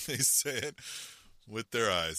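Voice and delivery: repeated through a chuckle; laughing. Shown as a demonstration. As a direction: say it again, laughing at the joke.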